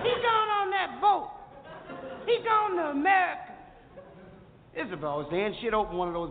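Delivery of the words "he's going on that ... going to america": put-on excited girls. Screaming girls at pier.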